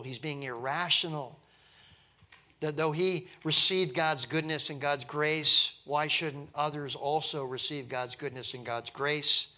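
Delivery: ordinary talk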